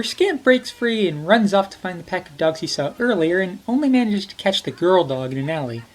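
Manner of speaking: normal talking voice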